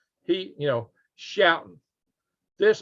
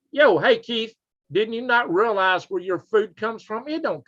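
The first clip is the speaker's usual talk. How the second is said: higher, challenging shout-out; mock calling-out; 7 semitones higher than usual. Host challenging Keith about food supply, mock calling-out. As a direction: call out an absent opponent, high and punchy.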